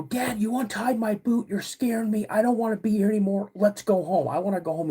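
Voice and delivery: high-pitched upset child imitation; retold tearful pleading. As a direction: plead with Dad like a scared kid. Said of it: "I'm absolutely crying".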